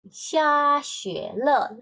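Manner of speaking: Xia xue le drawn out slowly, high and loud; teacher modelling Xia xue le for a student; slow demo. Shown as a demonstration. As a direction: spell out Xia xue le syllable by syllable. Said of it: "Le itu menunjukkan perubahannya".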